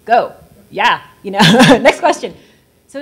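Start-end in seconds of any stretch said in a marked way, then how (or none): 0.0-1.0 mock curt dismissal. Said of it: "this man was like". 1.8-2.3 mock curt dismissal. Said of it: "this man was like".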